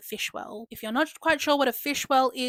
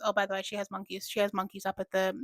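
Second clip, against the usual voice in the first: usual speaking voice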